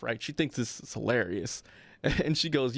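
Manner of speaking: ordinary delivery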